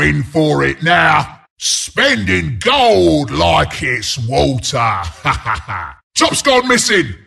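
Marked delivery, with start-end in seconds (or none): 4.8-5.9 low, softened laugh; low chuckle. Acted. Announcer amused at free spending, low chuckle. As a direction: chuckle deep and easy. Amused at gold being spent.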